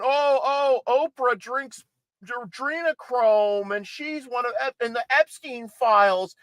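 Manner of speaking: high, sneering mock voice; mocking conspiracy theorists. Host mocking QAnon fans in a high, sneering voice. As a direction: parody the believers as gullible. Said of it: "All these QAnon morons".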